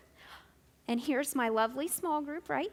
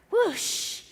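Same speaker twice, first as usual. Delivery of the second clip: whoosh; 7 semitones higher, 5 dB louder than usual. Acted. Whoosh sound after verse.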